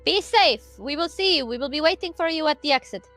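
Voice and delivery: normal talking voice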